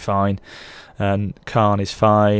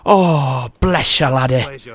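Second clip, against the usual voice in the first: slow, raised, hammed-up Scottish drifting into Irish; bad Scottish accent; 3 semitones higher, 3 dB louder, much slower than usual. Gamer answering the Scots in a hammed-up, slipping Scottish accent. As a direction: draw out a mock-Scottish blessing. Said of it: "but again, that's Irish".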